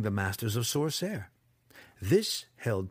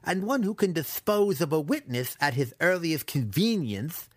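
higher, sly apprentice voice; 6 semitones higher than usual. A narrator's reading. Apprentice adds a veiled threat to his captive, slyly. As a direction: add it slyly, pitched up. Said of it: "Massage added slyly".

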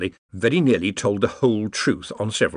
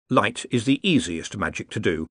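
ordinary delivery, nothing marked

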